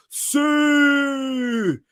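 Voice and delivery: long, high celebratory cry in mock Scottish; Scottish accent. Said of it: "I say Sue in a Scottish accent".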